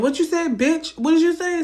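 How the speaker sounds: high yelled "What you said, bitch?"; shouting at him in front of neighbors; loud challenge. Retold or imitated. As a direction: call him out across the yard. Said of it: "So, like, I'm loud".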